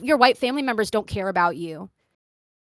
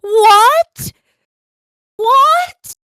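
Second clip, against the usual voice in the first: much higher, loud incredulous what; shocked disbelief; 12 semitones higher, 12 dB louder than usual. Retold or imitated. Reacting to the friend wanting Ben and Jerry's, shocked and loud. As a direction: yelp it out in disbelief.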